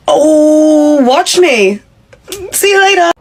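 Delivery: high, sing-song gloating exit; defiant taunt. Acted. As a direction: flounce out teasing the boss. Emily defiantly walks out on boss.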